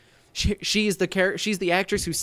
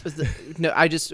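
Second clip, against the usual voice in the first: ordinary talk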